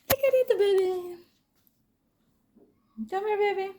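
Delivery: high-pitched pet call; coaxing a pet. Real, not acted. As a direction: coax a little animal over in a high voice. Calling the cat over.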